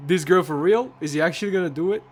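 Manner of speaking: loud, high disbelieving yell; incredulous outburst. Retold or imitated. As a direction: shout it like you can't believe it. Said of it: "He's like".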